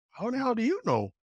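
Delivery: high-pitched, puzzled retort; imitated disbelief. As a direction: retell your baffled question, pitched well up. Retold surprise at driver's knowledge.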